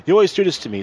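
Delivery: a hint of an annoyed complaining man; Roger scolding. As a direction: imitate Roger griping as he drags her. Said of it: "he was dragging, like".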